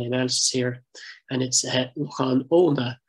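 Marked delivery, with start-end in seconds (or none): 2.0-3.0 Ona opened with a long "oh"; Ulster-style pronunciation. Shown as a demonstration. Lecturer showing the Ulster Irish way, deliberate pronunciation. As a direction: Lochan Ona, with Ona said "oh-na". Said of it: "pronounced here as it would be in Ulster Irish".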